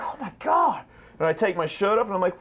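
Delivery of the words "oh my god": high, drawn-out shock; hammed-up horror; mock dismay. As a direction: gasp it out in disbelief. Discovering injuries.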